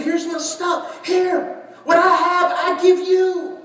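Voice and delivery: slow, high, loud acted offer; Jonathan giving. Jonathan offers David his belongings, slow and high. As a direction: give it all away, generously. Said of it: "He said".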